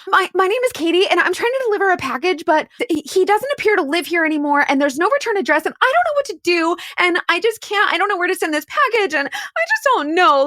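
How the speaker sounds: high, rushed, play-acted distress; frantic panic. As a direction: babble in put-on panic, running sentences together. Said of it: "I'm full blown panic mode".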